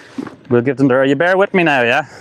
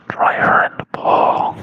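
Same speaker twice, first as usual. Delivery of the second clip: slow whisper; whispered code word; slower than usual. Shown as a demonstration. Testing the mic for viewers with a whispered code word. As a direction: breathe it out like a secret. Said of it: "can you hear the code word".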